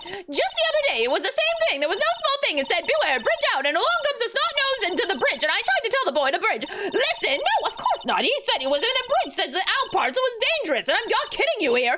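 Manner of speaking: put-on high, nonstop old biddy chatter; scolding old bag on a tirade; ranting old lady. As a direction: rattle on in a high-pitched crotchety old woman voice. Old bag's nonstop rant in game.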